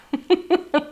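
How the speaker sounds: a bright little laugh of delight; quick high giggle. Real, not acted. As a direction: giggle at how cute it is. Gushing over a cute stamp.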